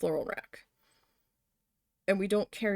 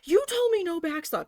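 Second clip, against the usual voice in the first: raised, high-pitched protest; upset meltdown; 8 semitones higher, 4 dB louder than usual. Retold or imitated. Retelling tearing into the boss over backstock, upset. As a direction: throw the boss's words back, worked up. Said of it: "I was almost in tears".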